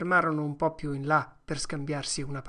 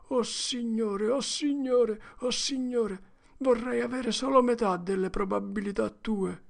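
wailing despair; 7 semitones higher than usual. Acted. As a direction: moan it to the sky. Huck wailing his sins.

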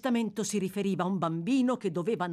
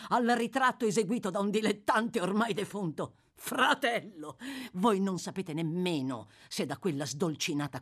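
higher, mocking villain with a derisive laugh; scornful, defiant Monks; 5 semitones higher than usual. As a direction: throw the accusation back with acted scorn. (cattivo beffardo e sprezzante)

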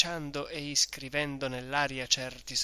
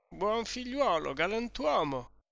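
put-on higher, dreamy drunken repeat; tipsy echo; 7 semitones higher than usual. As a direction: echo the flattery happily. Drunk Renzo echoing host. (eco brillo e compiaciuto, più acuto)

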